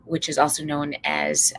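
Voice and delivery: ordinary talk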